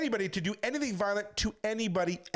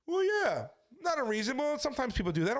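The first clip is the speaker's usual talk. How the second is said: lightly voiced ex-wife, pitched well up; high-pitched mock excuse; retold defensive excuse; 7 semitones higher than usual. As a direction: imitate her justifying herself, starting high and easing down. Voicing ex-wife making excuses.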